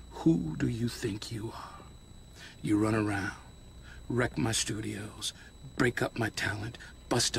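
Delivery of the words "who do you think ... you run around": menacing whisper. Acted. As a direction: let the menace sit in the whisper. Boss threatens captured rival.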